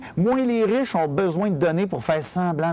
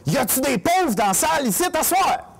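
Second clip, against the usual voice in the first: high-pitched call to the crowd; 7 semitones higher, 3 dB louder than usual. Real, not acted. Comedian polling the audience, calling out. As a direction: throw the question out to the whole room.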